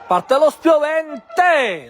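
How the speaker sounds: slow shouted build-up; breathless italian goal commentary. Real, not acted. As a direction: stretch it out as the cross flies. Replay of the cross, shouted. (cronaca concitata e urlata)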